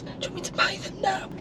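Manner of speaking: high, hushed conspiratorial whisper; mock whisper. Barbara on the phone to her niece, whispering. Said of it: "She said".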